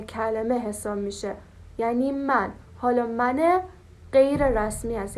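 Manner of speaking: ordinary talk, nothing marked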